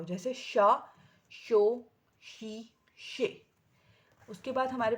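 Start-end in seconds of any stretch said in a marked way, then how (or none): 0.3-3.4 شا, شو, louder, then شی, شے drawn out slowly; blended without breaking. Shown as a demonstration. Teacher to parents, reading joined syllables without breaking. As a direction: read the syllables whole, no splitting. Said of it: "اس کو آپ توڑ کے بغیر بھی پڑھو".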